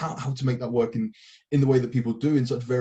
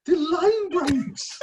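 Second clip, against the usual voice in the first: very high, very slow swoon; squealing gush; much slower than usual. Pretend. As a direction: swoon over it, pitched way up. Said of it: "repeated higher, slower".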